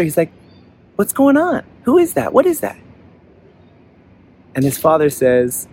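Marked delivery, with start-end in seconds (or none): 1.0-2.8 put-on high-pitched, puzzled child's questions; bewildered boy. A narrator's reading. Boy asks his father about the voice, bewildered. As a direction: pitch it right up, baffled and questioning.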